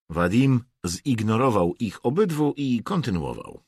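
usual speaking voice

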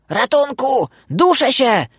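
high, strangled cry for help; panicked high-pitched duck wail; desperate screaming. A narrator's reading. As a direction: shriek as if choking. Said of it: "darła się rozpaczliwie".

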